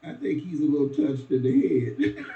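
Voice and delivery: high, put-on gossiping neighbor voice; mocking the nosy neighbors who won't babysit. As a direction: lampoon the neighbor begging off. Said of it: "They said".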